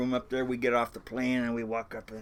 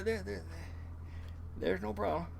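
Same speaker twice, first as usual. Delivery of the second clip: ordinary talk, nothing marked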